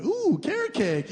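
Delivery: high, slow, gushing ooh; gushing to the waiter over the dessert menu, high and slow; delighted ooh. Retold or imitated. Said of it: "I went".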